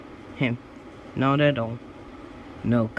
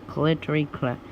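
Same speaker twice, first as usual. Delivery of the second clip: ordinary delivery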